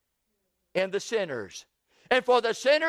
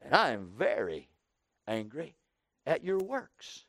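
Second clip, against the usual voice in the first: sulky complaint; 4 semitones lower than usual. Acted. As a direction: whine it out and let it sink low. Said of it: "looked at God and said".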